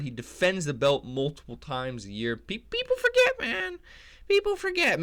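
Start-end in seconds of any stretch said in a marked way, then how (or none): 2.4-5.0 very high put-on squeaky voice; falsetto mock. Imitating Pantoja's emotional line, falsetto. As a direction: pitch it way up into falsetto.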